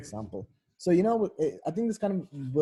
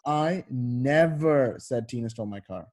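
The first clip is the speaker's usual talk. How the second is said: "never" stretched and stressed, slower line; slower than usual. Shown as a demonstration. Tutor correcting student's attempt, drawn-out stress on "never". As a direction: land long and firmly on "never". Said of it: "it's kinda like".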